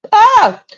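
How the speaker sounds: sudden high, loud mock gasp of surprise; a sharp, fake shocked "Ah" way up high. Mocking the parents' fake surprise at the news, with a loud high gasp. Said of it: "We're supposed to go".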